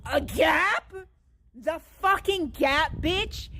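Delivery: loud, high, incredulous outburst; real scandalized outburst; outraged disbelief. Real, not acted. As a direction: spit it back in angry disbelief, stretching the words. Outraged rant over a remark.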